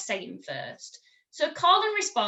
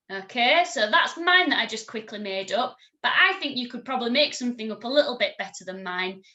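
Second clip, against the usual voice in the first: ordinary talk, nothing marked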